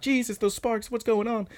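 very high pretend panic; squeaky imitation of alarmed girls; mock freaking out. Girls behind Kana watching the sparks, high mock panic. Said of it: "they're freaking out".

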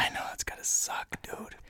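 hushed mock sympathy; whisper. Real, not acted. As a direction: whisper it like a secret.

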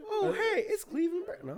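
very high squeaky cartoon impression; Cleveland Brown. Friend trying the cartoon voice, very high impression. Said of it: "No, see, I can't do it".

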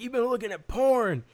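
high, loud indignant voice; indignant dopey guy. Retold or imitated. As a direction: play the dim guy taking offence. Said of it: "He's like".

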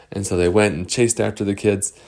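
plain reading voice

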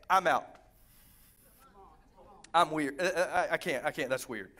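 sudden drop to a low deadpan; mock reluctant refusal. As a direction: back out of it in a low, halting mumble. Acting out a reluctant believer.

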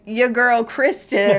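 drawn-out teasing call; imitating Jimmy. Husband teasing his wife about her friend, slow and loud. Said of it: "Jimmy will always come to me and be like".

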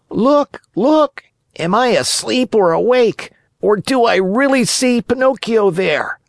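high-pitched amazed yell; wild yelling. A narrator's reading. Harlequin spots Pinocchio in the audience, yelling wildly. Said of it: "yelling wildly at the same time".

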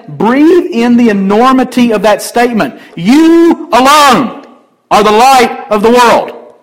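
rising pulpit fervour; full-force preaching. Acted. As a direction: preach it to the back row. Preaching peak on light.

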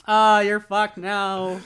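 slow, loud imitation of a Western accent. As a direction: draw it out like a mock Western cowboy. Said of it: "it's such a Western accent".

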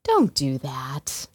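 slow, calm and firm; slow it right down, steady and even; a calm, measured no; calm warning. Retold or imitated. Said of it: "I just very calmly".

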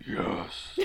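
whispered, breathy dragon hiss. Acted. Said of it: "That's a very good dragon voice".

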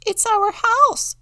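very high, loud, slow squeak; squeaky mouse. A narrator's reading. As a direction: squeak it like a frantic mother mouse. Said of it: "squeaked the mother mouse".

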